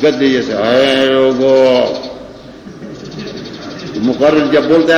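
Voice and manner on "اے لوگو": a drawn-out, donkey-like call to the crowd; mock braying preacher. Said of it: "بد آواز ان لوگوں کا ہے جو تکبر سے بولے". (گدھے جیسی کھنچی ہوئی نقلی آواز)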